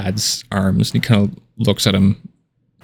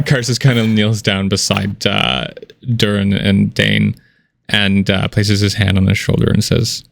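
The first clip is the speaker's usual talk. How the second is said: ordinary delivery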